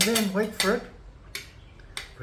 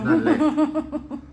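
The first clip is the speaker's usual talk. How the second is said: laughing; 13 semitones higher, 5 dB louder than usual. Real, not acted. Jokes all eaten.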